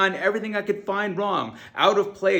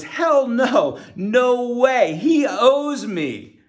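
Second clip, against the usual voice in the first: loud, higher, outraged no, slowing hard on the last words; indignant refusal; 5 semitones higher, 7 dB louder, much slower than usual. Retold or imitated. Recalling his first gut reaction to giving dad everything, loud indignant refusal. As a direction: bristle and refuse him flat out. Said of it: "my immediate reaction was".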